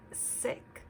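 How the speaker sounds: usual speaking voice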